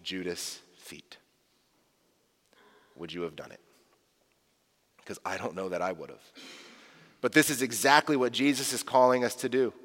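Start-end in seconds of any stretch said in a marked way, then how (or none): 3.0-6.3 quiet confession. Real, not acted. Pastor confesses own doubt.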